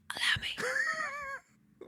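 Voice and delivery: slow, breath-only acted whisper. Suitor silently moving to her side in the carriage, slow whisper. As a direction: lean in and whisper it, drawn out. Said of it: "leans in to whisper".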